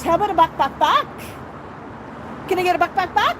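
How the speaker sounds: high, animated animal-call coaxing; playful coaxing. Pretend. Owner asking Max for a buck buck, playful and high. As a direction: coax a pet to copy the sound. Coaxing parrot with imitation sound.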